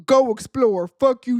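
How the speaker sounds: loud, rough retort in a put-on voice; mock Debo bark. The vlogger voices Debo telling him off, loud put-on bark. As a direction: spit it out like a bully cutting in. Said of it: "Debo comes from around the corner".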